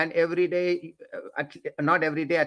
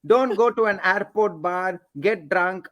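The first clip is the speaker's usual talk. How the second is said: ordinary talk, nothing marked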